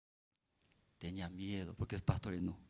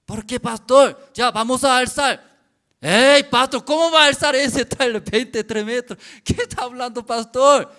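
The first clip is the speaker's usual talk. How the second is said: high, loud incredulous objection; loud protest; 11 semitones higher, 20 dB louder than usual. Retold or imitated. Workers shout back at pastor in disbelief. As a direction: shout your disbelief at him.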